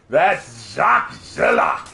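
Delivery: slow, hammed-up French name; cartoon French accent. Recalling the cartoon villain's name after the story, slow French accent. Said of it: "I was like".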